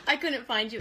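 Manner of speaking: ordinary talk, nothing marked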